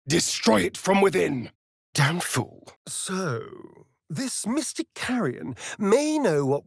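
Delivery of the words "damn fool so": ordinary delivery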